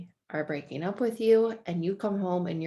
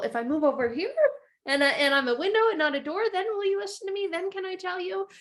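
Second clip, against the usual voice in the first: put-on hopeful kid; 12 semitones higher, faster than usual. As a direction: ask like a child hoping to be heard. Child asking dad to listen.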